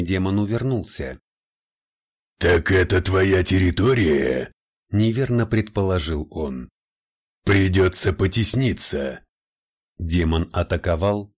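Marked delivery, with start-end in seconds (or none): 2.4-4.5 fire demon. Acted. Red demon taunts his rival. 7.4-9.2 fire demon. Acted. Red demon taunts his rival.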